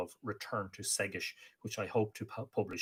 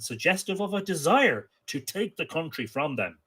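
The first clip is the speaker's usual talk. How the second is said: lively, slightly raised storytelling read; story narration; 6 semitones higher, 7 dB louder than usual. A narrator's reading. Storyteller reading an Irish myth to listeners, lively.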